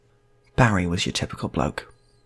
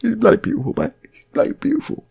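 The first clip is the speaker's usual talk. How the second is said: high-pitched awed gush; gushing bloke; 13 semitones higher, 4 dB louder than usual. Retold or imitated. Barry telling his mate, high and awed. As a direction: swoon over the art.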